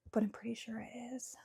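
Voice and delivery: whisper. Real, not acted. Hedging aside after an apology.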